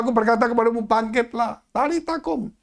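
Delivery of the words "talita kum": ordinary talk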